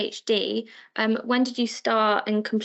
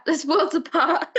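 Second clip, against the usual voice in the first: words spoken through a real laugh; 6 semitones higher than usual. Real, not acted. Interviewer amused by the brother's switch to sales, laughing. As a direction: laugh while you say it.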